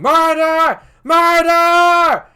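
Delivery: piercing high, full-volume scream; screaming murder. Acted. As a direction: shriek it as you flee. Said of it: "Lady Macduff crying".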